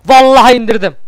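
loud excited cry of victory; triumphant shout. Real, not acted. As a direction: yell it as the enemy drops. Düşmanı vurunca bağırıyor. (zafer çığlığı)

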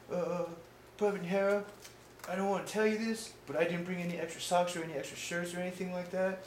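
high-pitched mock hapless private; sheepish confession. Private admits to his superior he packed no extra socks, sheepish.

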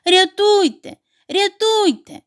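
high, loud cry for help; trapped duckling calling out for rescue. A narrator's reading. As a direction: yell from inside the basket. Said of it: "надриваючись, крякав у кошику".

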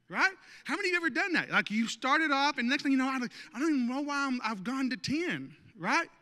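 ordinary talk, nothing marked

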